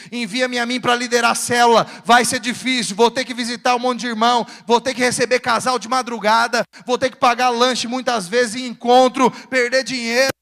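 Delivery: loud, steady pulpit declamation; driving preacher's list, building in force; forceful preaching. Acted. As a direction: declare each pledge on a sustained, insistent note.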